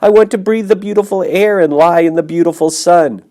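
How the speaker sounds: very high, loud tearful wail; moaning. Acted. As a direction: wail it out through tears. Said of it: "he moaned".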